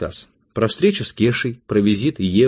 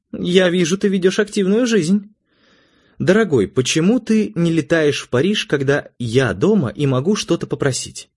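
high-pitched voiced wife; raised-pitch female figure, mildly reproachful; wife, pointedly teasing; 9 semitones higher than usual. A narrator's reading. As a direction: chide your husband sweetly as his wife.

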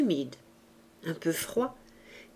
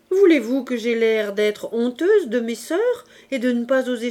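louder, offended mother protesting; indignant mother; 4 semitones higher, 5 dB louder than usual. A narrator's reading. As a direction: play the mother's wounded pride in rising questions. (mère indignée qui proteste)

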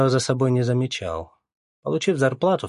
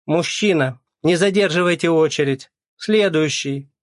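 higher, loud clerk's shout; shouting Оля sends him off; 7 semitones higher, 6 dB louder than usual. A narrator's reading. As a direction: bark at him to move the line. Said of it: "крикнула Оля".

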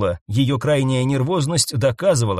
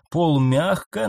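ordinary delivery, nothing marked

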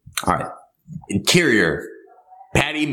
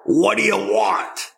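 a higher, pushy bartender line; gruff bartender; 9 semitones higher than usual. Acted. Bartender to customer, a lightly voiced demand. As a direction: bark the order question across the bar.

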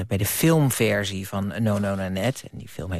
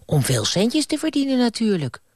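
put-on much higher, eager little boy's voice; childish Bram; 12 semitones higher than usual. Little Bram answering the ghost, high childish voice. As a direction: pipe it up like a greedy kid.

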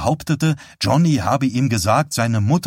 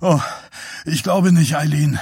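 strained, higher panting protest; out of breath from sit-ups; gasping; 5 semitones higher than usual. A narrator's reading. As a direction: groan it out between gasps. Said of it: "keuchte er".

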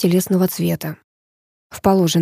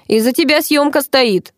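higher, mildly barked reproach; barking; 6 semitones higher than usual. A narrator's reading. Boss blames girl for stalled shoot, barking. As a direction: bark the blame, moderately. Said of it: "рявкнул Павел".

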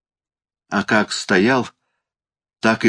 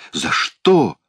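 higher-pitched startled question; stunned cry; 6 semitones higher than usual. A narrator's reading. Uncle questioned about a bribe, stunned cry. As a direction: demand it in mild shock. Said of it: "ошеломлённо вскричал дядя".

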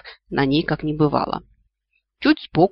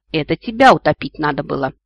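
plain reading voice

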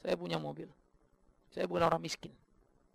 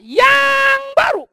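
loud shout, high and drawn out on baru; shouted answer; 19 dB louder, much slower than usual. Acted. Pastor shouting the answer to his congregation, loud and high. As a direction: bellow the answer, stretching it slow.

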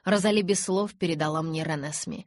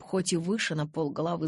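ordinary delivery

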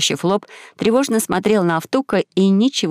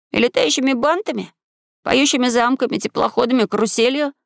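put-on high-pitched boyish questioning; high, questioning young voice; curious boy; 5 semitones higher than usual. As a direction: list it eagerly in a high boy's voice. Voices Maxim questioning eagerly.

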